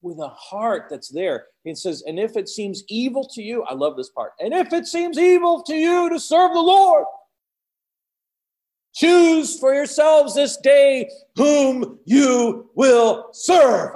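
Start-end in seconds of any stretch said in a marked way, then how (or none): 2.1-3.6 higher-pitched reading at normal volume; scripture reading. Acted. First reading before acting it out. 4.4-14.0 high, full-force shouted challenge; booming proclamation. Acted. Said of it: "I can just hear it".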